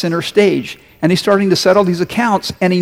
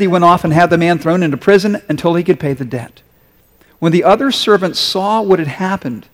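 usual speaking voice

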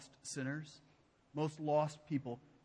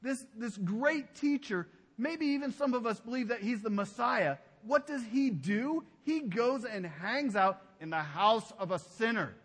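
righteous crowd mocking Jesus, sneering and pitched up; sneering onlooker; 4 semitones higher, 3 dB louder than usual. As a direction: scoff at the teacher who eats with sinners. They watched Jesus and go.

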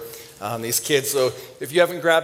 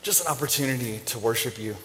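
offering prayer; 2 semitones lower than usual. Real, not acted. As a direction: pray simply, searching for the words. Prays over the offering.

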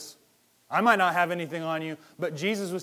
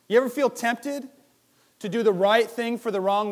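higher and louder, pushing the question; louder, pressing question; 6 semitones higher, 6 dB louder than usual. Real, not acted. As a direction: lean in hard on the question. Asks the same question again, louder.

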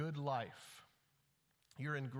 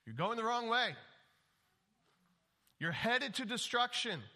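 much higher and louder, pleading warning; urgent rebuke; 7 semitones higher, 4 dB louder than usual. Acted. Preacher voicing Wisdom warning fools, urgent loud plea. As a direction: call them back from the cliff edge.

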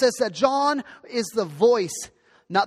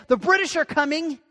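high mock yell; 6 semitones higher than usual. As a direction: call it out like a town alarm. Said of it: "John the Baptist yelling and saying".

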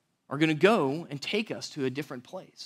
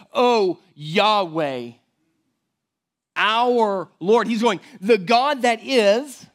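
louder and higher, building praise; rising preaching fervor; 5 semitones higher, 3 dB louder, slower than usual. Acted. Pastor exalting God's name to the congregation, fervently. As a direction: preach it with mounting force.

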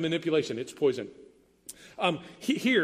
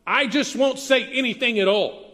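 higher, louder huffy retort; huffy outburst; 5 semitones higher, 4 dB louder than usual. Retold or imitated. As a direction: snap it out in a huff. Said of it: "Or maybe it sounds like".

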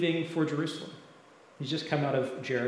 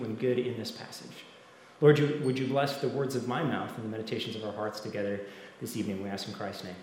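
hushed petition, sinking lower toward the close; prayer; 3 semitones lower than usual. Real, not acted. Leads the congregation in prayer.

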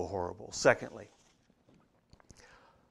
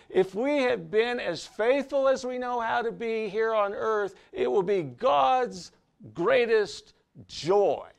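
loud, driving sermon voice, pitched up; preaching at full force; 6 semitones higher, 8 dB louder than usual. Acted. Pastor rousing the congregation against a bad quote, loud full-force preaching. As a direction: hammer it home to the congregation.

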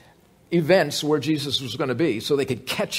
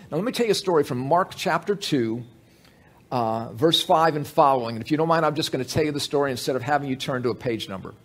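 ordinary delivery

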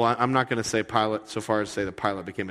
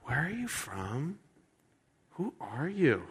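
slow, softer uneasy questions; nervous Pilate; 5 semitones higher, 6 dB quieter than usual. Retold or imitated. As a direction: ask quietly, unsettled and wary. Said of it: "Pilate is now nervous".

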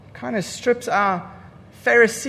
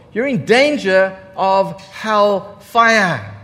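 slow ominous warning; 4 dB louder, much slower than usual. Retold or imitated. Climax of quoted warning.

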